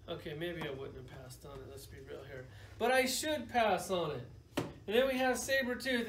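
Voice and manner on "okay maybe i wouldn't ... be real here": low, soft sheepish aside; quiet admission. Real, not acted. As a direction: own up under your breath after a sigh. Sheepish admission after a sigh.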